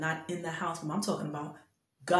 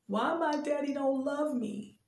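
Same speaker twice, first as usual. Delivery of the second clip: put-on lifts the voice higher for a small child's hurt question; voicing the heartbroken child; 8 semitones higher than usual. Retelling the child's question on the phone, lightly voiced higher. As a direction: echo the little girl's plea, higher, with a light touch.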